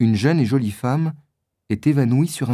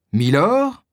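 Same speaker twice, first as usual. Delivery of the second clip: s'étonner du titre, voix qui monte; étonné; 6 semitones higher, 3 dB louder than usual. Acted. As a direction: question surprise, montée plus haute. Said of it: "s'écrie D'Artagnan à son tour". (D'Artagnan s'étonne d'entendre ce titre, voix montante)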